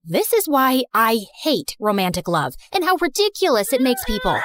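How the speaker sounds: ordinary delivery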